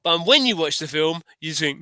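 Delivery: ordinary talk, nothing marked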